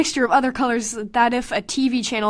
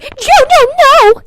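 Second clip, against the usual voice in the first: high, loud hammed-up wail; mock anguished cry; 14 semitones higher, 10 dB louder, much slower than usual. Mocking the lover's cry as she jumps in. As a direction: overdo his despair. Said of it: "he's like".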